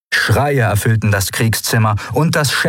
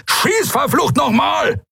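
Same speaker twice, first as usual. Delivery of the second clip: very high, strained acted shout; roaring order; slower than usual. A narrator's reading. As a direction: yell the order over the noise of battle. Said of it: "brüllte Kero neben ihr". (hoch gebrüllter Befehl)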